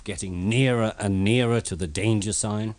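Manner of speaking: usual speaking voice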